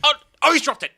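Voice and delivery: high loud mock-horrified cry; hammed-up commentator shock; shocked commentator yell. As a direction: scream as the star drops it. Commentator reacts to disaster.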